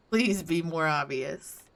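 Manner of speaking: ordinary delivery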